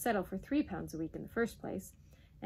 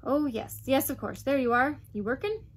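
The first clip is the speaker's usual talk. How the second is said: loud, higher, brusque Duke; blustering; 5 semitones higher, 7 dB louder than usual. A narrator's reading. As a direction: boom it at him impatiently. Blustering Duke greets Carraclaw loudly.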